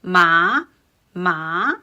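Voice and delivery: MA rising at the end; second tone. Shown as a demonstration. Teacher demonstrating the second tone, "ma" with a rising voice. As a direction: let "ma" climb upward like a question. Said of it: "M A with the second tone".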